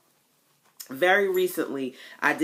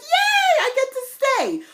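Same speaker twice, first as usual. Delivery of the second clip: very high and louder cheer; gleeful yay; 7 dB louder, slower than usual. Retold or imitated. Learning she can stay, gleeful high cheer. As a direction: cheer like a kid let off school. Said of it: "I was like".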